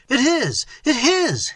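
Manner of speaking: high, loud insistent cry; insisting to the friar, high and loud. Acted. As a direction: fling it out, high and urgent. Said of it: "cried Theodore".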